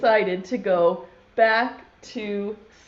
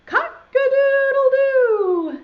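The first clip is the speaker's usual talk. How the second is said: loud, high, drawn-out crow; rooster crow; 14 semitones higher, 6 dB louder, slower than usual. Acted. Teacher leading children in the rooster's call, crowing. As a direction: crow it slowly and loudly like a rooster. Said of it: "then everyone has to say".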